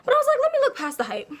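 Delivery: panting between words; breathless after ranting; out of breath. Real, not acted. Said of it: "I'm out of breath".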